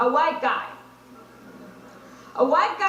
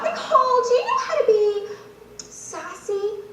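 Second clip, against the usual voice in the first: high, hammed-up casting director; mock director giving a note; 5 semitones higher than usual. Casting director voiced, asking for sass.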